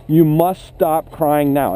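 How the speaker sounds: slow, deliberate, no-nonsense order; very direct command. Shown as a demonstration. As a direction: spell it out firmly so it sticks. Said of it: "be very direct with them".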